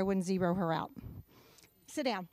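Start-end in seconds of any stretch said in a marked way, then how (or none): none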